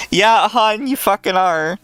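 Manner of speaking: loud and high, mocking; sarcastic retort. Real, not acted. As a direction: snap back a scornful answer. Mocking reply to read quote.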